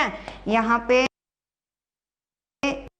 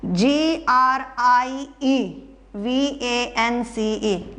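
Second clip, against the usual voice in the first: letters spelled out slowly one by one; slow spelling out; much slower than usual. Shown as a demonstration. Teacher spelling out a word for students, slowly letter by letter. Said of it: "grievance की spelling ठीक है बच्चे".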